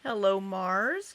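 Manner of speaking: ordinary talk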